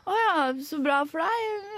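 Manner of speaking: high, slow, sugary fake enthusiasm; retelling a fake-impressed reply to a bragging guy, sarcastic. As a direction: drip mock delight at his bragging.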